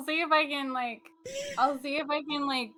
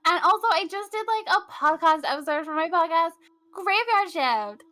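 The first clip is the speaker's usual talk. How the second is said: excited bright promo; 6 semitones higher, 4 dB louder than usual. Real, not acted.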